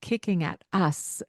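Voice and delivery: ordinary delivery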